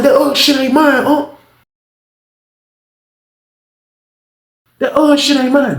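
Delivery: high, strained protest; pleading denial. Retold or imitated. Cornered man insisting the stash isn't his, high pleading. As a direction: beg him to believe you, pitched high.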